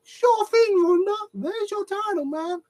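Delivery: overly eager yes-woman voice; sarcastic high chirpy reply; mock sweet Stephanie. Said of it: "Stephanie's like".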